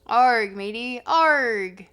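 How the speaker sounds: loud mock pirate growl; hammed-up pirate exclamation. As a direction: bark it like a pretend pirate. Playful pirate imitation.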